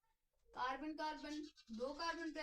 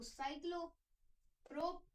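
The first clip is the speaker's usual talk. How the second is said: slow dictation; 5 semitones higher, much slower than usual. Shown as a demonstration. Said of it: "और cyclic form में है".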